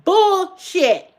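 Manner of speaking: ordinary talk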